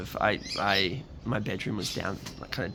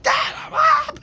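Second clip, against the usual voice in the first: very high, loud, drawn-out yell; hammed-up exasperated holler, slow and loud; escalated mock shout; 12 dB louder, slower than usual. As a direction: yell back at Dad at full stretch, acted. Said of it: "everything would escalate".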